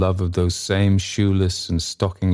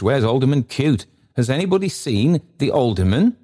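higher, anxious calling out; great agitation; 6 semitones higher than usual. A narrator's reading. Secretary hunting for the alderman in great agitation. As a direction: ask all around in a raised, agitated pitch. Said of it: "in great agitation".

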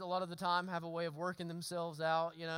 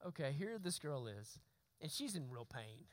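inner thought; 7 semitones lower, 11 dB quieter than usual. Retold or imitated. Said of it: "And I thought".

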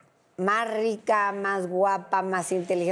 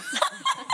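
a sudden high laugh breaking out; high squeal of a laugh; burst of laughter; 3 dB quieter than usual. Real, not acted. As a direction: laugh right through the story. Laughs mid-story.

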